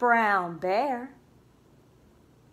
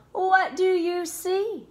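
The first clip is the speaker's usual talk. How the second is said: plain reading voice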